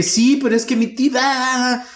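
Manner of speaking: high, slow pleading whine; high drawn-out complaint; whiny protest. Retold or imitated. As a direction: whine it like a wronged son. Protesting to mom about aunt.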